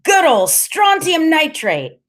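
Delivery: high, slow, relishing cry; happy kid calling it out slowly; delighted exclamation. A narrator's reading. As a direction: savour it high and drawn out. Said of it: "he goes".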